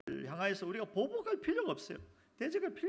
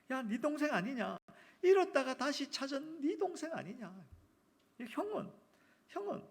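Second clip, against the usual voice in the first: higher, insistent appeal; urgent father; 7 semitones higher than usual. Acted. Father urgently pressing elder son, high.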